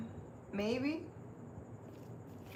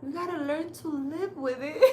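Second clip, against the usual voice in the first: put-on higher, softer, slow tender old lady voice; sweet old psychic; 5 semitones higher, 3 dB quieter than usual. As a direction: coo it like a kindly grandma giving advice. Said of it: "She's like".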